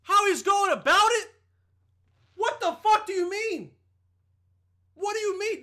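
incredulous outrage. Real, not acted. Reacting to the country guy's comment, incredulous and loud. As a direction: blast back at the absurd remark.

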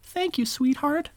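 high, sugary grandma voice; high-pitched chirpy old woman; sweet old lady voice. Retold or imitated. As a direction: play the kindly fabric store lady. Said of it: "Bethany goes".

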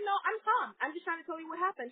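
high-pitched retold protest; acting out an exasperated explanation; defensive insistence. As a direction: insist on calm, wound up underneath. Retold insistence that she is calm.

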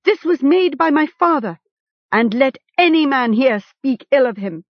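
high-pitched, forceful protest; defiant cry. A narrator's reading. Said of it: "he cried".

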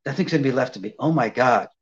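low, slow melting sighs; overwhelmed gush. Retold or imitated. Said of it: "She said".

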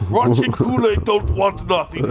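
put-on Russian accent. Comic plays Russian Kool-Aid refusing pretzels, hammed-up Russian accent. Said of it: "I like your Russian accent".

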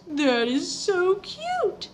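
put-on high, slow, cooing baby voice; mocking baby talk. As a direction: gush over it like to a toddler. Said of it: "she said in a baby voice".

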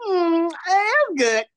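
high, very slow and drawn-out; little girl voice. Retold or imitated. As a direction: coo it like a shy little kid. Little girl answers about movie.

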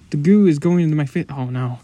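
loud, fast panic; panicked. Acted. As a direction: blurt it out in terror. Goo attacking his face.

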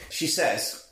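ordinary talk, nothing marked

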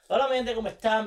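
big podcast welcome; show intro. Acted. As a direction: hype up the audience like a radio host.

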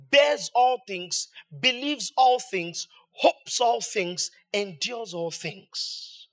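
each phrase drawn out slowly; slow emphatic reading. Real, not acted. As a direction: weigh every word of the list.

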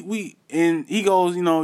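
normal talking voice

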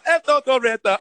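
jokingly mimicking the crab's bad Jamaican accent; mock Jamaican accent. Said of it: "horrendous Jamaican accent".

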